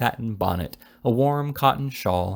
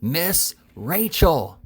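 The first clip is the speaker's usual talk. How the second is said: very slow, higher and louder "Miss Rachel!", each syllable stretched; roaring; 6 semitones higher, 3 dB louder, much slower than usual. A narrator's reading. Told to speak louder, the fat boy roars the name into her ear. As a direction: bellow the name slowly into a deaf ear. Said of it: "roared the fat boy".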